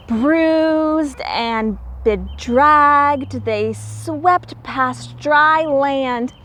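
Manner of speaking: animated storybook narration; reading to children. A narrator's reading. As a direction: read it like a bedtime picture book. Reads picture book aloud.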